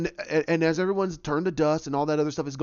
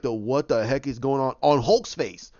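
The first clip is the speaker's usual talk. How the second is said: ordinary talk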